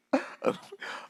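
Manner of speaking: a high, airy laugh under the breath; breathy laugh. Real, not acted. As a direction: laugh off your own rant. Laughs after going crazy.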